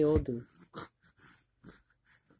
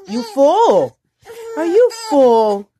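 loud playful question; 9 semitones higher, 11 dB louder than usual. Real, not acted. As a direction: call out to the little one teasingly.

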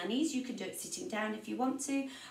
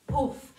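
mock oof; 3 semitones higher, 5 dB louder than usual. Shown as a demonstration. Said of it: "big stompy boot".

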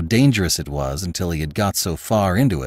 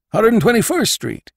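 higher, louder gossipy landlord voice; Jacobus, landlord; 5 dB louder than usual. Acted. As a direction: play the nosy old landlord, pitched up.

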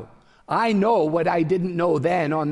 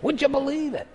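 imitated Cleveland accent, pitched much higher; 9 semitones higher than usual. Older friend teasing the preacher, a Cleveland accent. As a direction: Cleveland old-timer's catchphrase, up high. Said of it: "in a very sort of Cleveland voice".

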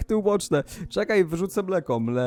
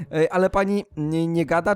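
ordinary talk, nothing marked

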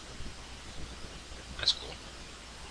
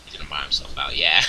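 ordinary talk

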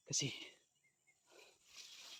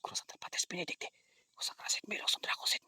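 whispered incantation; 11 dB quieter than usual. Acted. Whispered mock Latin incantation.

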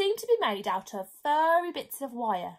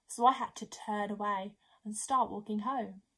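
ordinary delivery

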